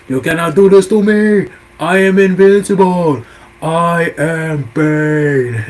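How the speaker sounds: hammed-up boastful villain; villain rant. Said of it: "Bane goes".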